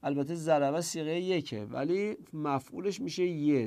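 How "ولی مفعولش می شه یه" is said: normal talking voice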